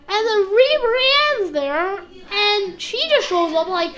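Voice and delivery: normal talking voice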